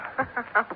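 short teasing laugh; amused laugh. Acted. Widow laughs at the startled detective, teasing. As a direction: laugh at his surprise.

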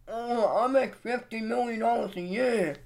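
lightly put-on MrBeast voice; mock MrBeast voice. Vlogger answers his own fake interview in a light MrBeast voice. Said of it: "Mister Beast".

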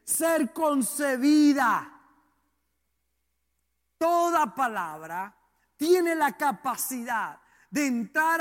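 slow, emphatic sermon declaration; forceful preaching. Real, not acted. Preacher declaring the power of every word, slow forceful preaching. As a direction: proclaim it phrase by phrase, stretching key words.

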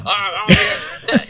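dismissive. Pretend. Mocking reply, slow.

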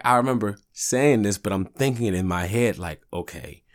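normal talking voice

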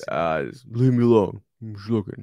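put-on grumbly giant, shooing them off; a mock gruff Hagrid, brushing them away. Cohost showing his own Hagrid to the reader, mock gruff. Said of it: "he does kinda have this low grunt".

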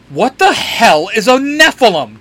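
loud, high-pitched demand; baffled outburst. A narrator's reading. Dean demands to know what they are, baffled outburst. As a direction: burst out, incredulous and loud. Dean reacts to strange word.

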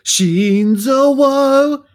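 loud, high, drawn-out rallying cry; playful, full-voiced anime battle cry; mock battle cry. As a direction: stretch it out like a soldier's salute. Rallying cry as podcast introduction.

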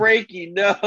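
high, alarmed mock yell of Frankie, no; alarmed cry. Brothers cry out to their creation in mock alarm. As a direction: yelp the name in mock horror. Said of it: "And they're like".

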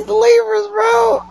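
real laughing yell about Libras; laughing shout. Real, not acted. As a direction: hoot it out through laughter.

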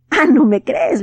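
put-on goblin daring the boy to doubt him; challenging goblin. A narrator's reading. (el duende desafiando al niño, con voz más aguda y fuerte)

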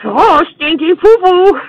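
high, slow silly cartoon voice; a hammed-up baby-talk squeal; goofy silly voice. Silly voice banter continues.

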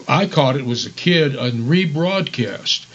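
usual speaking voice

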